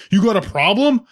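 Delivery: imitating a guy squaring up; mock challenge. Confrontation after bottle hits.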